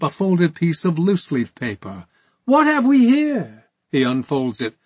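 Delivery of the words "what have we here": high, loud feigned discovery; mock surprise. A narrator's reading. Interrogator producing evidence against a priest, in mock surprise. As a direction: sing it out as if you had just found it.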